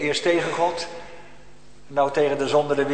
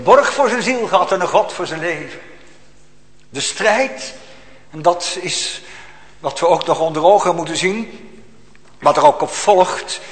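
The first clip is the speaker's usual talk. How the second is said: loud, higher, urgent preaching; preaching at full force; 5 semitones higher, 9 dB louder than usual. Acted. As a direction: hammer each line home.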